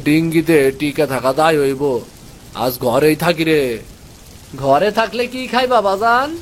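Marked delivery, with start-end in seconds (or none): 4.6-6.3 put-on higher boyish voice, worried; a boy asking what they'll eat; young son. Son questioning his father about food, higher boyish voice.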